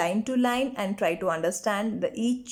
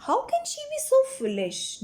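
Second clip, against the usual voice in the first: put-on scornful old man; faster than usual. Old painter scolds about Johnsy.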